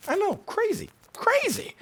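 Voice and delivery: very high, sarcastic squeak of disbelief; mock incredulous. Pastor mocking his own surprise that he has flaws, high and sarcastic.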